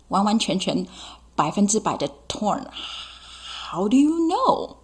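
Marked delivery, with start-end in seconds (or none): 3.7-4.8 skeptical voice. Pretend. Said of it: "teacher voices skeptical question".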